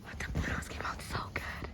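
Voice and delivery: hushed, fast whisper of delight; whispered amazement. Real, not acted. Reacting to printed photos.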